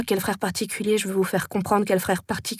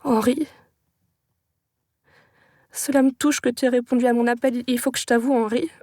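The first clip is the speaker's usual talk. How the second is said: slightly raised, pleading to a friend; play-acted emotional scene; 3 semitones higher than usual. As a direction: act out a touching confession, lightly. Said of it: "je lui joue la scène".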